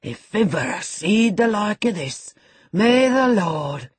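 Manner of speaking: high, loud, slowing into a prayer; horrified. Acted. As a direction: cry out in pious horror. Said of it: "in horror".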